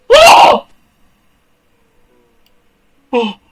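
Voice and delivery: sharp high loud yelps; startled yells. Real, not acted. Gamer yelping at each shock on screen. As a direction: jump at each scare. Game jumpscares, repeated yells.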